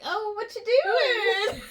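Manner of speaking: high, slow, drawn-out mock casual; hammed-up casual phone greeting; imagined boyfriend tone. Boyfriend answering her surprise phone call, hammed-up casual tone. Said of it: "only what I could imagine his tone".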